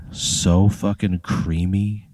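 slow and savouring, dwelling on every word; slow mock food-critic relish. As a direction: linger over the taste, slowly. Savouring the sandwich out loud.

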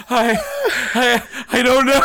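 put-on high, halting, laughing kid; high timid kid voice. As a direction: answer like a scared little kid. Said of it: "And I just go".

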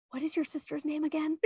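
put-on child whispering a secret to her mum; mimicked whisper. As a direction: hush it like a secret, quickly.

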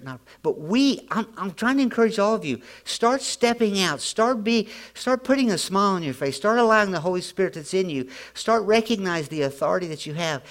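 loud, high, driving preacher's voice; preaching at full force. Acted. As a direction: preach it out hard to the room. Urging the congregation to step out.

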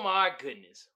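ordinary delivery

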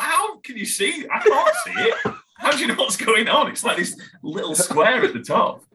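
very high, squeaky retold incredulity; hammed-up, high-pitched bafflement; laughing disbelief. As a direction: protest in amazement through laughter. Retold disbelief at mum's tiny screen.

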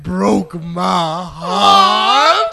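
slow, hammed-up wailing lament; mock heartbreak. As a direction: drag it out like a fake sob.

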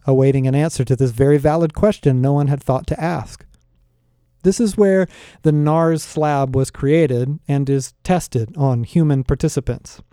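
plain reading voice

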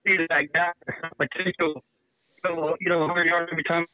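breaking up on a bad phone line; choppy cutting-out call audio; buzzy glitchy call audio. Real, not acted. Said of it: "You're breaking up on me".

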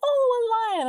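high, loud cooing wonder; mock delight. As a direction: imitate thrilled discovery up high. Voicing Natalie delighted by lion.

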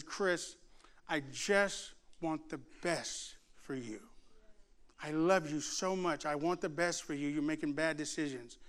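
soft, low, pained pleading; tearful mother, imitated. Crying mother to rebellious son in his room, understated sorrow. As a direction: gently voice a crying mom's plea. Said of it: "and she's crying".

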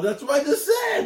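rising to a loud, high protest; indignant, climbing holler; exasperated shout. Real, not acted. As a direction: build to an exasperated yell. Mock exasperation at co-host.

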